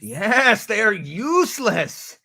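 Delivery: slow, high stress on "useless"; drawn-out, high, emphatic confirmation; emphatic agreement. Acted. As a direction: stretch "Yes" and "useless", pitched high. Emphatic repeat of the verdict.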